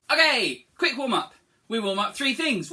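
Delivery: bright loud okay; rally the class; loud call to start. Real, not acted. Teacher to children starting warm-up, loud call.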